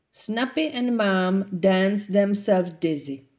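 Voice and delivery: ordinary delivery, nothing marked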